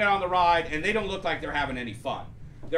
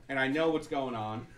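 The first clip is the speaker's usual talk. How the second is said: usual speaking voice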